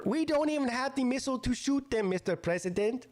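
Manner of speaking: high-pitched mock Pakistani accent; badly faked Pakistani accent, pitched well up. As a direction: put on a terrible Pakistani accent. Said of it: "That was the worst Pakistani accent".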